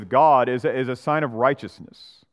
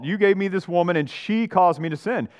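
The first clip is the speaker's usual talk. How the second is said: higher, louder, whiny protest; stammering excuse of a cornered man; defensive blaming; 5 semitones higher, 4 dB louder than usual. Acted. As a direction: push the blame away hard, voice climbing. Adam acted blaming, emphatic.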